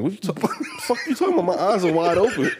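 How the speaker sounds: high, fast, laughing outburst of disbelief; incredulous protest. Retold or imitated. Retelling how he answered the manager at the conference, high laughing disbelief. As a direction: protest with a laugh as if wrongly accused. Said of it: "I'm like".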